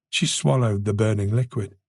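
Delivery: ordinary delivery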